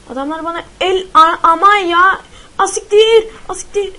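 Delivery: high, very loud alarmed cries; alarmed shout. Real, not acted. Gamer shouting as enemies attack. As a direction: shout as they jump you.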